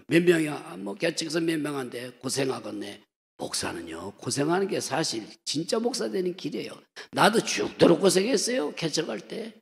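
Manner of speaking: ordinary talk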